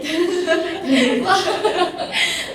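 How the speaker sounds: loud, high-pitched real laugh; burst of laughter. Real, not acted. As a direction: laugh out loud at your own admission. Laughs after admitting feeling insecure. (tertawa lepas)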